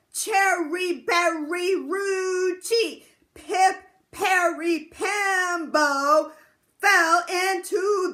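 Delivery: drawn out, loud and singsong; very slowly. Acted. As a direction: stretch every syllable slowly. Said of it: "very, very slowly he said".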